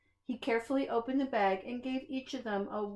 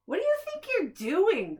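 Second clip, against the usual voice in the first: high, loud outraged cry; indignant cry; 10 semitones higher, 4 dB louder than usual. A narrator's reading. Grouch scolding the gentle monster, outraged cry. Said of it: "cried Grouch".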